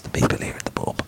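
hushed, secretive whisper; mystical whisper. Acted. Telling friends fairies surround them, in a whisper. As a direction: confide it like a sacred secret.

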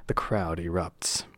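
quiet breathy aside; whispered. Real, not acted. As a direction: drop to a whisper. Aside while narrating.

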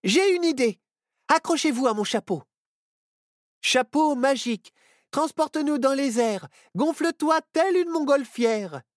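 high shouted magic spell; shouting. A narrator's reading. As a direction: cry out the spell to the hat. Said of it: "quand Myrtille hurla".

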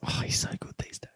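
hushed whisper aside. Real, not acted. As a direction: whisper it under your breath. Whispered aside about Zaha.

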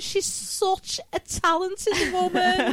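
high, loud Scouse gush; thick Scouse, drawn-out and effusive; Scouse accent. Acted. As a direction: gush it in a broad Scouse lilt, pitched right up. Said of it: "people with Scouse voices when they're like".

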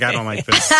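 laughing. Retold or imitated. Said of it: "I'm like".

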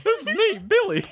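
very high, loud silly character voice; Billy. Acted. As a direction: announce yourself in a squeaky high pitch. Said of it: "That's Billy's voice, by the way".

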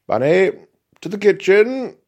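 loud, high alarmed call; urgent shout. Acted. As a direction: yell to flee to the kitchen.

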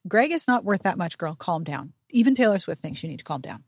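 ordinary delivery

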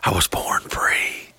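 slow, soft whisper; hushed, drawn-out mock-heroic reply; whispered mock defiance. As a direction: whisper it like a solemn declaration.

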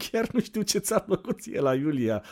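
put-on high scornful girl with a laugh; scoffing. Anda scoffing at the narrator's old crush, high and scornful. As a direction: scoff at his taste. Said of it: "pufnește Anda".

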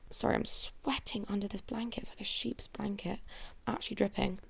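soft, whispered side comment; whispered aside. Real, not acted. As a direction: drop to a whisper for a quick aside. Quick aside about the blanket.

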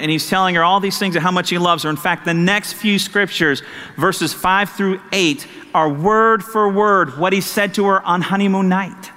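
ordinary talk, nothing marked

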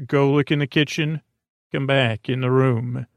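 usual speaking voice

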